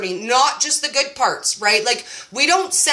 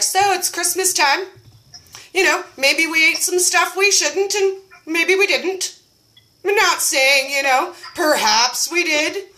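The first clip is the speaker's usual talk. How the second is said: higher, teasing sing-song hedge; coy video voice; 5 semitones higher than usual. Retold or imitated. In her house coat telling the group, coy and teasing. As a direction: wink at the viewers. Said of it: "I just made a video and I was like".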